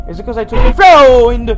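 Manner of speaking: rising to a loud, high yell; mock-outraged shout; indignant question. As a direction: burst out loudly on the last word. Confronting game character after scare.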